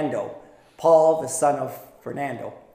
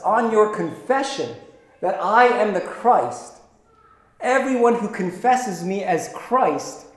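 ordinary talk

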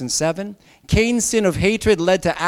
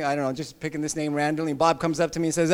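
ordinary talk, nothing marked

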